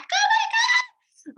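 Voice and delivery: high, slow delighted squeal with a laugh; squealing with joy at her launch; ecstatic squeal. Retold or imitated. Woman recalling her launch, ecstatic laughing squeal.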